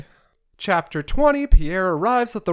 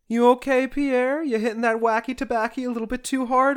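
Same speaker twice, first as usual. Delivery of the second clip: higher, teasing voice, a touch faster; higher, faster teasing aside to Pierre; mock concern; 7 semitones higher than usual. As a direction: needle him like a sarcastic friend. Narrator mockingly addresses Pierre.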